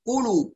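"كلوا" said as "قلوا", heavy back q; thickened ك. Shown as a demonstration. Teacher imitates a common mistake, thickened consonant. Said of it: "تتعرض أحيانًا للتفخيم".

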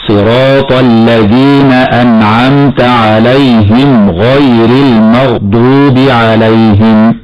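slow, measured melodic recitation; correct Quran recitation. Shown as a demonstration. Sheikh demonstrating the verse, slow tajweed recitation. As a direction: chant it slowly with lengthened vowels. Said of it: "هكذا".

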